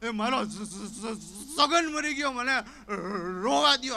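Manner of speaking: high, loud, slow sob-stutter on સ-; stammering wail. Retold or imitated. Stammering friend wailing at the wrong house, mock grief. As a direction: howl the grief, stuck on the first sound.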